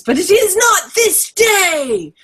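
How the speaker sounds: high, slow, drawn-out battle shout; hammed-up epic war cry; rallying speech. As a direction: declaim it like a hero rallying an army. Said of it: "the speech from Lord of the Rings".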